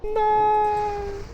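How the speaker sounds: very high drawn-out no; anguished cry. Real, not acted. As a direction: wail as you die. Dying in game.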